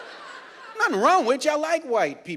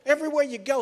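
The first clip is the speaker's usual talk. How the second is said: ordinary delivery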